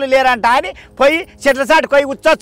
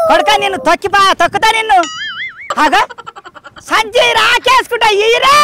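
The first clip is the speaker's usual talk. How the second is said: angry scolding; 8 semitones higher than usual. Acted. Mother scolding son over bag.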